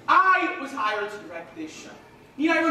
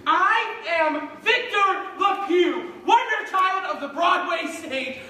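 grand shout; 5 semitones higher, slower than usual. Acted. Director shouting his own grandeur.

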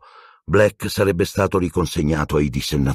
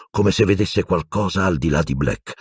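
plain reading voice

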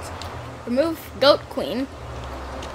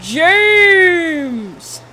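megalomaniac rant; 4 semitones higher, slower than usual. Pretend. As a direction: lord it over the peasants. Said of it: "I snap in as the almighty Goat of Power".

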